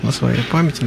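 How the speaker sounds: ordinary delivery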